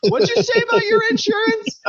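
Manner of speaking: very high-pitched mock question; startled listener. Parent reacting to the insurance confession, high-pitched mock startled question. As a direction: squeal it as a baffled reaction.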